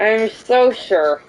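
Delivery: slow, tight, forced agreement; through gritted teeth. Acted. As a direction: grit your teeth through it. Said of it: "that sounded like more of a gritted teeth moment".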